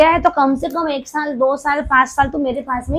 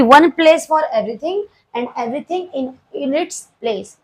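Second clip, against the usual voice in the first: slow dictation; much slower than usual. Shown as a demonstration. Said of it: "teacher slowly dictating principle".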